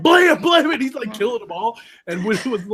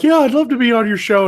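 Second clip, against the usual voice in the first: higher, louder eager voice; eager young woman; 7 semitones higher, 8 dB louder than usual. Retold or imitated. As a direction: gush about joining the show. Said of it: "she's all like".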